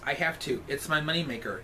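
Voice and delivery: ordinary talk, nothing marked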